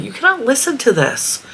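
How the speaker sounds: high mocking teen voice; high put-on whiny daughter. Retelling daughter's objection, mocking.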